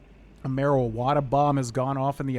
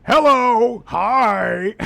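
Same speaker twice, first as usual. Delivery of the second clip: higher, loud goofy voice; silly orc greeting; 6 semitones higher, 8 dB louder, much slower than usual. Retold or imitated. Friends goofing around in voices, loud and silly. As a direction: bellow a cheerful hello in character. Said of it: "we do that exact same thing".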